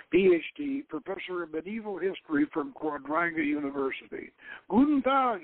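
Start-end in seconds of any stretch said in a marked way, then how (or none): 4.7-5.4 German greeting. A narrator's reading. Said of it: "he greeted them".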